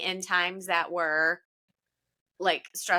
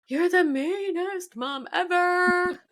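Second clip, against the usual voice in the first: put-on high, slow whining child protest; whiny kid voice; 10 semitones higher, slower than usual. Son whining at his mom over lost dessert, high and slow. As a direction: play the sulking son crying out at mom.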